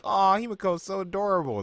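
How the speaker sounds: high, loud mock coo; hammed-up adoring squeal; gushing. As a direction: coo over something cute. Acting out own gushing reaction.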